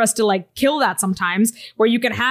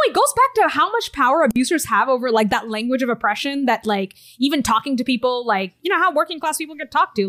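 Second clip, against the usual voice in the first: ordinary delivery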